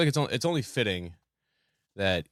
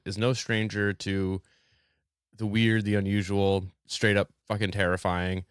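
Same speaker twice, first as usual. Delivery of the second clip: usual speaking voice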